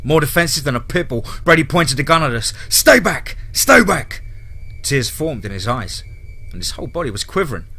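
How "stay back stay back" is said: loud, high repeated shout; panicked warning. A narrator's reading. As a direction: shout to keep them away. Defensive Brady warns at gunpoint.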